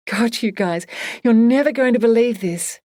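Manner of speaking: hardly containing big news; excited friend on speakerphone; excited booming voice. A narrator's reading. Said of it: "Simone's excited voice boomed out".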